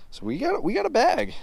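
thrilled to have a full bag; excited. Real, not acted. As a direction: voice jumping up with excitement. Excited catch reveal.

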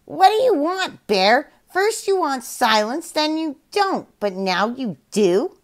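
high squawky parrot voice; puzzled parrot asking the bear. Acted. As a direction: parrot questioning the confused panda in a high voice. Parrot speaks own mind.

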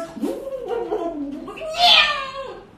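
bubbling splash into a high yowl; gurgle, then screech like a cat in water; dunked cat. Acted. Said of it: "that's the cat".

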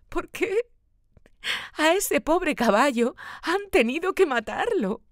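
put-on high child voice choking on sobs; sobbing child. A narrator's reading. Boy sobs to his father over the killed horse, high and tearful. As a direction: cry it out like a heartbroken little boy. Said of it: "dice entre sollozos".

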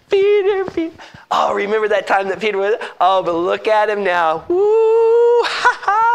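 high, cackling devil's taunt; mock poor Peter with gleeful high laughs; gloating Satan. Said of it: "Satan's doing a happy dance".

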